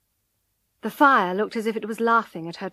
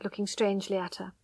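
ordinary delivery

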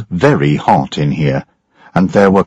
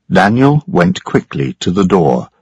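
usual speaking voice

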